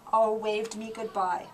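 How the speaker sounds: ordinary delivery, nothing marked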